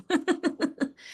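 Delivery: high, loud burst of laughter; amused cackling laugh; real laugh. Real, not acted. Storyteller amused that neighbor fixed his fence too, real laughing.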